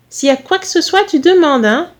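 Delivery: high-pitched maternal advice; sing-song mother-hen concern; fussing mother. Acted. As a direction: press help on your son. Mother fusses over son.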